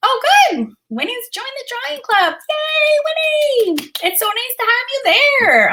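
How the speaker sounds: high, delighted cheer for a newcomer; excited welcome. Real, not acted. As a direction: greet a new member with a high, happy squeal. Welcoming a new club member.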